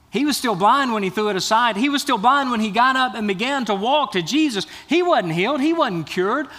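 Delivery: emphatic preaching. Real, not acted. Preacher on the beggar's faith, emphatically. Preacher repeats the point with force.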